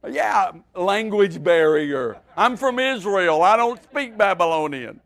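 loud comic protest, slightly eased off; mock yelled excuse. Preacher playing a reluctant believer dodging the fire, mock yelling. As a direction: plead the silly excuse loudly. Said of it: "we'd have been yelling".

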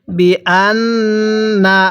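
very slow, drawn-out syllables; model recitation. Shown as a demonstration. Teacher models Quran words for learners, slow measured recitation. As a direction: stretch each long vowel evenly. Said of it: "আমার সাথে পড়ুন".

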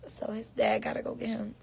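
normal talking voice

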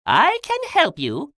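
a high, loud offer with a hint of villain; high-pitched storybook bad man; Rumpelstiltskin. A narrator's reading. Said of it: "Rumpelstiltskin was a bad man".

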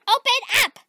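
loud, high call through a door; demanding yell. Acted. At the girl's front door, demanding yell. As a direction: yell it impatiently, high and punched.

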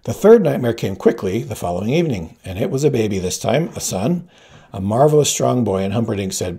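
plain reading voice

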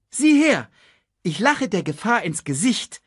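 high, loud showing off; boastful. A narrator's reading. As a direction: brag like a fearless cub. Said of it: "prahlte Simba".

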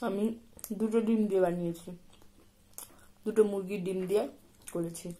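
talking with food in mouth; speaking between bites; chewing while talking. Real, not acted. Seen 0: bit food at -4; lips pressed, chewing motions while she talks.

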